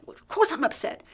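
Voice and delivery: a touch of an annoyed surgeon; irritated retort. Retold or imitated. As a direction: toss it back, offended.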